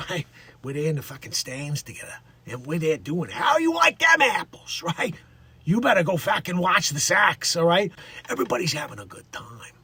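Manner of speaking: put-on thick Boston townie accent, tossed off. Joking about Baldwin's real hometown, Boston accent. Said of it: "I guess you could get a Boston accent".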